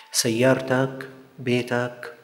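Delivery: normal talking voice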